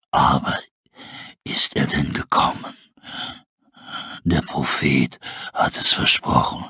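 very low, slow breathy wolf rasp; a dying wolf barely whispering; weak wolf. Acted. Starving wolf asking about his prophet, faint rasp.

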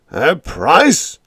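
loud, high disbelieving shout; outraged shout. A narrator's reading. Captain outraged at Biggs's price, loud shout. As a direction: raise the roof in outrage. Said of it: "Hansen's voice lifted the roof an inch".

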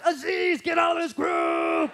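high, drawn-out mock yell; Harris in all caps. Harris attacking his cousin publicly on the boards, all-caps yell. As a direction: stretch the last words into an outraged cry. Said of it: "He writes in all caps".

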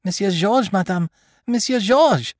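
high, rising panic calling out; distraught nurse. Acted. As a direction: call for help, near hysteria. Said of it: "She seemed distraught".

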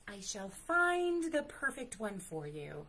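ordinary delivery, nothing marked

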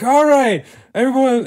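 high, loud stage shout; calling to a crowd. Retold or imitated. Imitating the musician addressing a huge crowd, calling out loudly. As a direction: call out over a huge audience.

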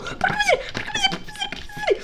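very high, small squeak; fretting in a tiny high voice; panicked squeal. Real, not acted. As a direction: squeak it out rapidly. Panicking in game.

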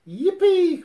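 very high, loud whoop; yippee shout. Acted. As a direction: whoop it out with joy. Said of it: "cried Tigger".

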